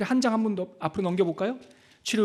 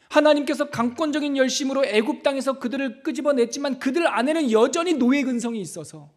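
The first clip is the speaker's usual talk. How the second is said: raised, pressing preacher's voice; higher, insistent sermon delivery; forceful preaching; 5 semitones higher, 4 dB louder than usual. Real, not acted. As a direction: drive the point home to the congregation.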